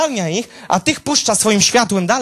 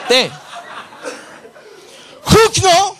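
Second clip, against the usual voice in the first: booming exclamation; 11 semitones higher, 5 dB louder, much slower than usual. Acted. Narrating God's thunderclap.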